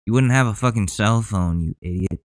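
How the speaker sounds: ordinary delivery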